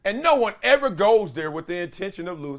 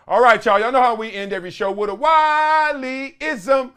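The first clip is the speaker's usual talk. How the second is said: showman's announcement; 6 semitones higher, 8 dB louder than usual. Real, not acted. Host introduces his closing Wiley-ism to viewers, showman's announcement. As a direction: belt out the signature segment intro. Show's signature segment announcement.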